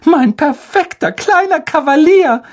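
very high doting mother; adoring sigh. A narrator's reading. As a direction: swoon over your little darling in a piping voice. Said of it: "seufzte Tante Petunia".

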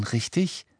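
ordinary delivery, nothing marked

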